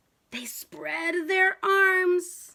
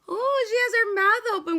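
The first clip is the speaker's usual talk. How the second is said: surprised delight; 6 semitones higher, 5 dB louder than usual. Real, not acted. Excited picture-book reading.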